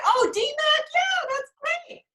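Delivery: very high, slow, gushing fan voice. Retold or imitated. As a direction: squeal it like an excited fan. Retold own gushing reply.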